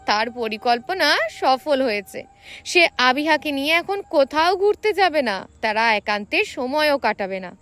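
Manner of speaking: ordinary delivery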